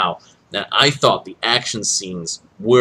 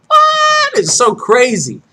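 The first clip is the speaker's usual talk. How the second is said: shrieked high, loud "what?"; incredulous; 12 semitones higher, 6 dB louder, slower than usual. Real, not acted. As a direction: explode in disbelief. Stunned by the movie.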